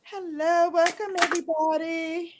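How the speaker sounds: high, welcoming hello to the chat; high bright greeting. Real, not acted. Host greets viewers with a high welcome.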